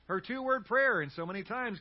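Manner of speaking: ordinary talk, nothing marked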